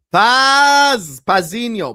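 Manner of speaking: loud high call across the room. Real, not acted. Streamer calling out to his friend Paz, loud. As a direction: shout his name to get attention.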